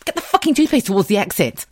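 high, loud outburst tipping into laughter; exasperated shout. Retold or imitated. Wife to husband who did it again, exasperated shout. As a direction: snap and yell the order. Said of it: "And I just went".

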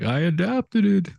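high, slow mock squeaky voice; squeaky Tom Hardy voice. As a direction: lightly squeak it out like a mismatched tough guy. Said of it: "like a squeaky vo-, almost like a squeaky, higher pitched voice".

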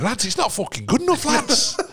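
hammed-up gruff manager, half laughing; old-school English manager. Neil Warnock giving a player a kick, high mock rant.

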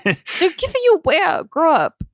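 high, far-fetched mock British accent; Statham's British accent. Co-host as Shaw taunting Hobbs in prison, mock British accent. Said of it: "That fucking accent".

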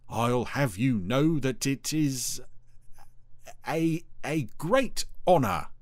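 slow, self-important boast; puffed-up pride, easing off at the end; proud Dougal. A narrator's reading. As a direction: draw it out proudly, hesitating mid-phrase. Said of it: "he said proudly".

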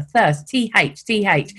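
"thirst" and "T H" spelled out slowly, like a pedantic correction; drawn-out, over-careful "thirst, T H". Retold or imitated. Boss drilling her on TH, "thirst, T H" slow and spelled out. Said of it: "and he'd be like".

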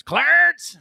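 long high call across the yard; calling out Clarence. Retold or imitated. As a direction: holler the name, drawn out. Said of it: "he used to call Papa Clarence".

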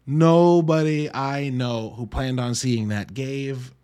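ordinary delivery

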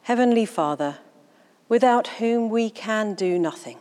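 ordinary talk, nothing marked

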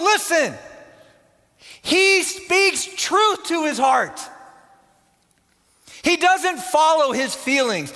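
high, loud, impassioned preacher; full-force preaching. Real, not acted.

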